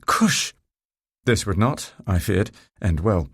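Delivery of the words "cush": high-pitched, contained shout of "Cush"; shouted command. A narrator's reading. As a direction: call "Cush" high to urge on a donkey. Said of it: "while shouting".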